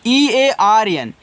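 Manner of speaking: slow, careful spelling of पी एल ई ए एस ई; letters spelled out one by one, slowly. Shown as a demonstration. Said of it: "spelling letters".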